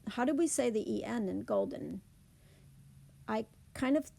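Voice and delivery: ordinary talk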